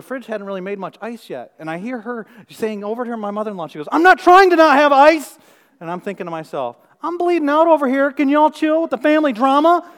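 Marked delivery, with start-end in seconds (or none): 3.9-5.4 high, loud, slow defensive whine; mother-in-law. Retold or imitated. Mother-in-law defends herself to sister-in-law, high and loud. 7.0-9.9 high, loud exasperation; exasperated. Retold or imitated. Bleeding man fumes at squabbling in-laws, loud and high.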